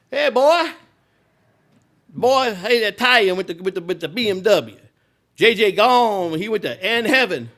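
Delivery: put-on high, loud, gleeful voice of the old owner; mocking old boss. Old ballpark owner calls at night, gloating over a dead friend, high and loud. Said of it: "He's laughing on the phone".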